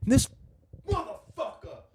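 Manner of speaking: high-pitched, hushed mock fury; mad Italian. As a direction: squeeze out the curse softly, imitating a seething Italian. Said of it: "when Italians really mad when they go".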